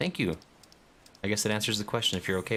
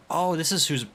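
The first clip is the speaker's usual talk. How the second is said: sudden realisation; 10 semitones higher, 5 dB louder than usual. Real, not acted. Realises who is nearby.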